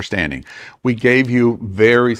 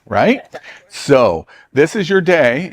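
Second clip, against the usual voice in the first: normal talking voice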